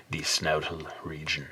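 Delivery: half-whispered "snoutal region", fond and low; hushed. Real, not acted. Coining "snoutal region" for a horse's snout, hushed. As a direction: murmur the made-up word lovingly. First take of the word.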